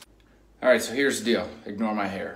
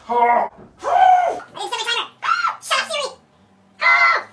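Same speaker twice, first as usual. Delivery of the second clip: high yelping from icy cold; shocked yelps; 8 dB louder than usual. Real, not acted. Jumping into ice bath.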